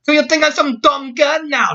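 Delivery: loud, high-pitched Scots outburst; angry shout. Acted. Scotswoman taking offence at Jack, shouting. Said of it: "she shouted at him".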